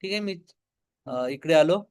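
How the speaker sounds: ordinary talk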